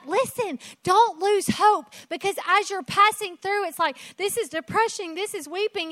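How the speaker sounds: high, eager voice of God urging hope; God's voice, encouraging. Retold or imitated. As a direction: speak as God lifting them up. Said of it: "So he's like".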